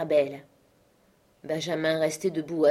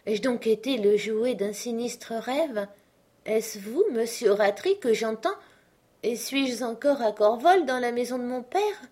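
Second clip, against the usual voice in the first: put-on raised, pleading girl's voice, lost; dying daughter, confused and frightened; bewildered young woman; 6 semitones higher than usual. A narrator's reading. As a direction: ask as if half in a dream. Arabelle wakes, confused.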